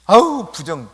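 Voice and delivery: loud, recoiling exclamation; acted disgusted rebuke. Crowd shunning the beggar as unclean, loud disgusted rebuke. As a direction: burst out in mock disgust. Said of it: "꾸짖습니다".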